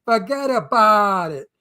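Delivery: teaching a student the real Brooklyn "Forget about it"; Brooklyn accent. Shown as a demonstration. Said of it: "that's not how you say it".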